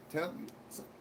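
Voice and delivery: whispered tell me something, no voice. Acted. Whispering the request back to himself. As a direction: breathe the words out in a whisper.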